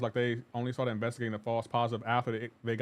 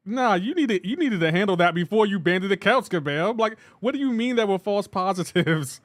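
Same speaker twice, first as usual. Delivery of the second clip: louder and higher, worked up; raised incredulous rant; exasperated rant; 6 semitones higher, 5 dB louder than usual. Real, not acted. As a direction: call Kabam out with disbelief.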